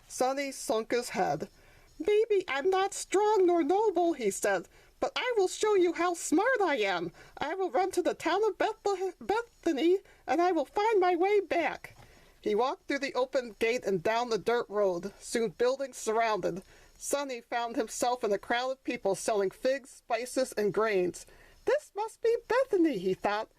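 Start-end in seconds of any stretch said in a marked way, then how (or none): 2.0-4.1 put-on high childlike voice, louder; young donkey, stung. Sonny after being laughed at. 5.0-12.0 put-on young donkey, determined. Sonny vows to prove smart. 21.6-23.0 put-on high childlike voice, pleased; hopeful little donkey. Sonny thinks he arrived.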